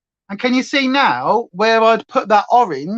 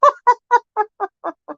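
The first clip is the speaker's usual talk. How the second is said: high laugh; 3 dB louder than usual. Real, not acted. Laughing at own joke.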